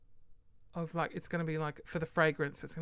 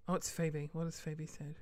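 normal talking voice

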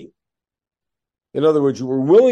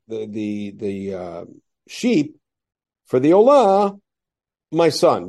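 ordinary talk, nothing marked

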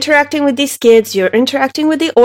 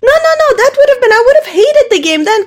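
incredulous; 10 semitones higher than usual. Real, not acted. Laughing incredulous protest.

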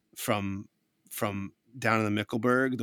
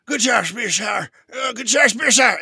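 high, loud dreamy mumble; sleep talking; 11 semitones higher, 10 dB louder than usual. Acted. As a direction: call out from a dream. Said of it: "Despo talking in her sleep".

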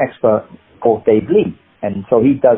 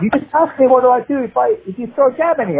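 higher retorting self; 11 semitones higher than usual. Retold or imitated. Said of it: "I said".

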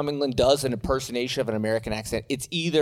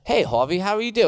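American's English accent, pitched up at the end; put-on English accent; 5 semitones higher than usual. Shown as a demonstration. Host auditions for English guest, put-on English accent. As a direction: show off a fake English accent. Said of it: "I love my English accent".

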